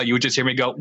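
usual speaking voice

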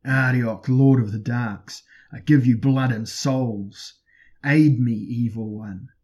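loud, slow plea to a dark god; full-voiced summoning; screamed invocation. A narrator's reading. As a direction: cry out for aid, stretching the words. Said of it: "He was screaming the name of Arioch".

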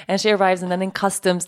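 usual speaking voice

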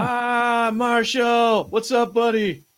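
high, bright welcome to a viewer; cheery shout-out to a chat regular; excited greeting. Real, not acted. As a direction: call out to Marshall happily.

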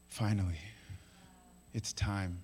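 low, very soft voice; hushed, spaced-out words; hushed relief. Acted. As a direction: let it out quietly after a long wait. Angels voiced, awed relief.

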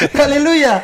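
laughing church-style hallelujah; preacher exclaiming. Retold or imitated. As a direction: belt it like a joyful churchgoer. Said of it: "orang Kristen?".

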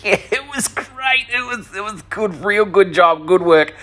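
high, strained, overdone approval; squeaky, embarrassed compliments, hammed up; awkward praise. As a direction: imitate forced enthusiasm in a high voice. Awkward praise after lap dance.

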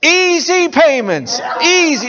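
high, loud, slow sales pitch; home shopping host. Retold or imitated. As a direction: stretch out the easy payments. Continues infomercial pitch.

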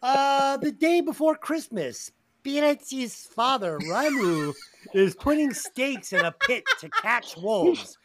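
put-on a half-joking Mexican-in-France accent for the film summary. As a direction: read the synopsis in a mock Mexican-in-France accent. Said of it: "You sound like a Mexican living in France".

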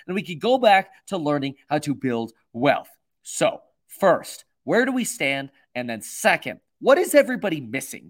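energetic, emphatic news-commentary read; presenter delivery. Real, not acted. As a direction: open the segment briskly and punch key words. Starts recorded segment in presenter delivery.

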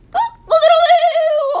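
very high, loud crowing; full-out rooster call; cocky rooster crow. A narrator's reading. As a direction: crow it with a long last note. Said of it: "a cocky rooster crowed".

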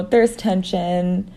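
ordinary delivery

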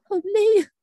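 loud, high groan of real exhaustion; tired exclaim. Real, not acted. Complaining to a friend about work, a loud tired groan. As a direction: let the tiredness burst out.

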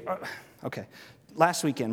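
ordinary talk, nothing marked

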